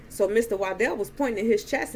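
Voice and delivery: usual speaking voice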